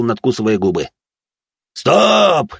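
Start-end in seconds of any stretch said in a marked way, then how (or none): 1.7-2.5 high, loud sudden call; sharp yell, pitched way up; referee's shout. Acted. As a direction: shout a halt to the fight. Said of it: "кричит судья".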